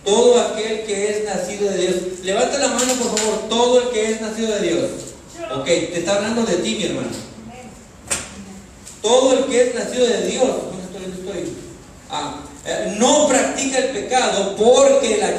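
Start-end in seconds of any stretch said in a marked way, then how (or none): 0.0-10.5 loud, emphatic sermon delivery; full-force preaching. Real, not acted. Preacher driving a verse home to the congregation, full-force preaching. 13.0-15.4 loud, high, emphatic verse reading; full-force preaching. Real, not acted. Preacher quizzing the congregation on the verse, full-force preaching.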